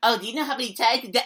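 fast, sneering parody voice; mocking imitation. Mimicking the man who questioned his face touching, fast mocking. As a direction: rattle off his smug question. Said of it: "what the motherfucker said".

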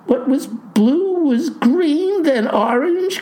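slow, high-pitched bewilderment; distraught cry. A narrator's reading. Clerk recounts farmer's hair colors, distraught cry. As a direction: cry it out high, at wits' end. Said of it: "cries she".

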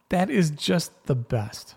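slow, drawn-out and fond; a slow, fond repeat that savors the moment; savoring it. Real, not acted. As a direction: linger on each word as if you love it.

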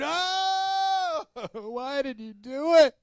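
high, slow hammed-up groan with a laugh; groaning sailors. Preacher acts sailors groaning at Jonah, high and laughing. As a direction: overplay the sailors' dismay, laughing. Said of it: "It says they groaned".

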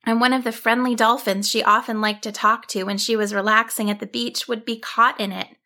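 plain reading voice

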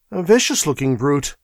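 ordinary delivery, nothing marked

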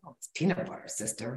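hushed whisper to herself, sister at the end; whispered aside. Retold or imitated. She whispers the correction to herself, hushed. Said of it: "I was like".

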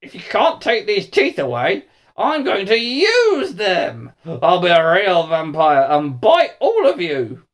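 loud, high, menacing snarl, drawing out the threats; vampire snarl. A narrator's reading. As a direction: snarl it through vampire teeth, building to a shout. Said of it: "he snarled between his vampire teeth".

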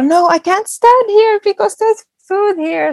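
a comic high-pitched startled voice; mock alarmed participant. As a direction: play a walker suddenly afraid to step on food. Imitating startled people on walks.

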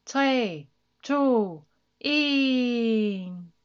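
loud, drawn-out countdown. A narrator's reading. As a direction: count down for launch, last word held long. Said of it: "hun talte ned".

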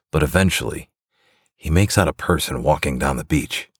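ordinary delivery

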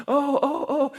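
high-pitched wailing "oh, oh, oh"; wife's distressed ohs. Retold or imitated. Wife over her fallen husband, high distressed ohs. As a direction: imitate a flustered, high-voiced "oh" repeated. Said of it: "his wife's going".

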